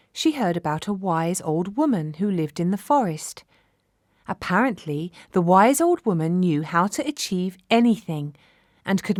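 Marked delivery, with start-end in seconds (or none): none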